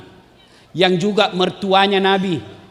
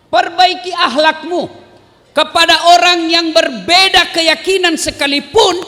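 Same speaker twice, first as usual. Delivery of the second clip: preacher urging the crowd, raised and fervent; rousing preaching; 7 semitones higher than usual. Acted. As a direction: call the congregation out.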